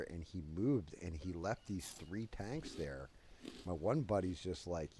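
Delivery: ordinary talk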